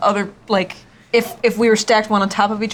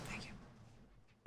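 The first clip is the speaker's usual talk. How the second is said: whispered; 19 dB quieter than usual. Acted. Lizard servant thanks, whispered.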